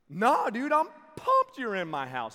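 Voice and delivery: loud, high, over-eager reply; hammed-up excited welcome; mock enthusiasm. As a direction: play pumped to appease a scary stranger. Retold over-eager answer to intruder.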